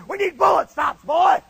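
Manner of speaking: loud, high drill instructor shout; Flanagan. Retold or imitated. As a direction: shout it at the recruit like a sergeant. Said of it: "he talked just like this".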